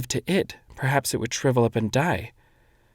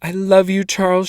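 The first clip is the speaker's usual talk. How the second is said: high, louder cry through sobs; sobbing cry; 8 semitones higher, 6 dB louder than usual. A narrator's reading. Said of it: "she cried again, her sobs".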